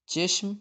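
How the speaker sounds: چشم pitched high, said "CHESH-m"; e vowel demonstrated. Shown as a demonstration. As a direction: model چشم clearly with an "e". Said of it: "زیر کے ساتھ". (چشم، زیر کے ساتھ، اونچے سُر میں)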